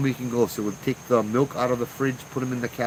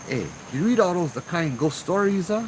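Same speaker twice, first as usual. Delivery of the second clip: lightly voiced uncle, pitched up; uncle in conversation; 8 semitones higher than usual. Uncle asks young Joe about ghost stories, eager questioning. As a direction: lift the voice as the uncle asking.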